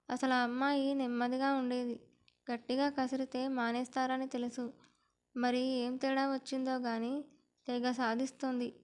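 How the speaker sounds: ordinary delivery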